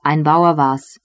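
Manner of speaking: ordinary delivery, nothing marked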